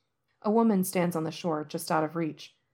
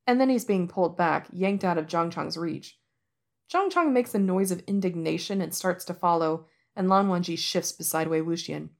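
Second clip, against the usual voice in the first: plain reading voice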